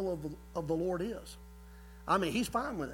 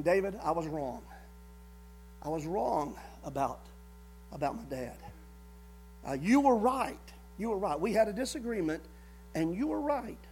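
humble confession; 5 semitones lower, 7 dB quieter than usual. Acted. Jonathan admits he was wrong.

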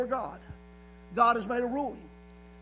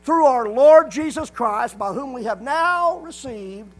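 loud, measured scripture reading; preaching; 7 dB louder than usual. Acted. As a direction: proclaim the verse slowly and forcefully.

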